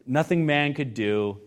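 low, soft, settled assurance; quiet reassurance. Acted. Preacher reassuring anxious worshippers before worship, low and soft. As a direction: bring it right down to soothe an anxious heart.

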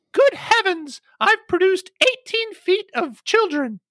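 very high put-on warbling voice; mother quote in Julia voice. Quoting Julia's mother, in high warbling voice. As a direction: pipe it up high like a grand old lady. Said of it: "her mother is quoted to have said".

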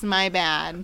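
usual speaking voice

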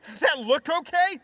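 high, loud panic with a pinned leg; barely holding off panic; panicked. Acted.

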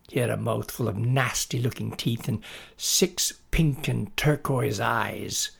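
ordinary delivery, nothing marked

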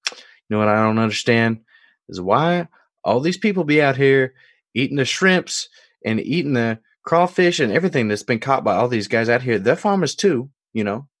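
put-on Jerome's Deep South accent. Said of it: "that's the accent".

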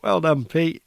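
the lads cheering him in, half-teasing; bright teasing well done; mock cheer. Group greets him at the van.

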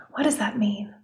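whispered question to herself. Real, not acted. Host pondering the word aloud in a whisper. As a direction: breathe the question softly.

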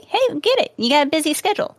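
usual speaking voice

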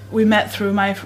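ordinary talk

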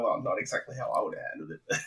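quick, quiet, lightly voiced contrary old man; Pierce impression. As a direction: toss off "not exactly" as a grumpy muttered aside. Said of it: "doing his Pierce thing".

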